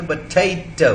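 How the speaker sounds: पोटैटो in three separate syllables, full vowel after P; पोटैटो slowly broken up, keeping the first weak vowel; syllables said separately. Shown as a demonstration. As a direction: say पोटैटो syllable by syllable, puh-TAY-toh. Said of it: "इसको हमने separately बोला".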